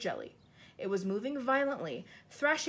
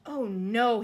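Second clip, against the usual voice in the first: dismayed cry; 8 dB louder than usual. Acted. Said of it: "he cried".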